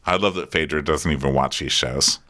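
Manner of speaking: usual speaking voice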